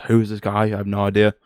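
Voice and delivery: ordinary talk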